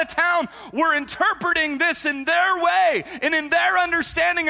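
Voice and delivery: shouted, high-pitched sermon warning; full-force preaching. Real, not acted. Pastor warning church against deceivers, full-force preaching shout. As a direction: thunder it out to the congregation.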